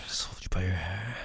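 soft commanding whisper. Acted. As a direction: whisper the order close. Intimate roleplay, whispered instruction.

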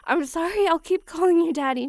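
usual speaking voice

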